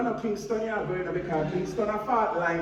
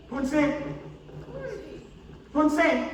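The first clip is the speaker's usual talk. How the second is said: rising calls to get her attention; calling out a name; 7 semitones higher, much slower than usual. Acted. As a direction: hail her from a distance, building up. Calling out to spotted friend.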